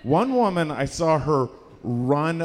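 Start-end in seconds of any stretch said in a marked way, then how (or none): none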